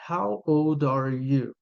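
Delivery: put-on "How old are you?" said slowly; slow model question. Shown as a demonstration. Teacher modelling a question in English. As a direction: ask it slowly and clearly. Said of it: "se alguém perguntar pra mim".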